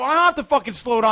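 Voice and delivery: high, loud defiance; reenacted shouting match; retold angry retort. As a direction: fire back at the other driver. Retold argument with a driver.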